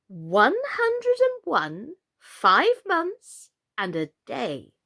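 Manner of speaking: slow, savoured announcement; slow reveal. Acted. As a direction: draw it out proudly. Slow proud age reveal.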